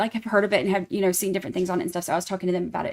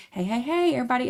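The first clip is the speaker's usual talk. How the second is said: ordinary talk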